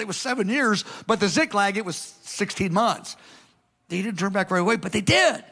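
ordinary delivery

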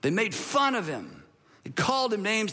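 usual speaking voice

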